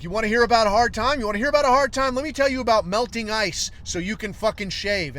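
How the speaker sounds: loud, high, fast rant in imitation; visibly angry. Science teacher rants to the class about hard times, imitated anger. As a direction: act out an old teacher getting pissed off. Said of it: "getting visibly angry".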